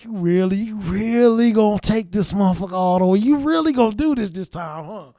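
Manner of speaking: high, loud taunting disbelief; excited disbelief. Retold or imitated. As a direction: call out at the rocket, amazed. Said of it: "I was like".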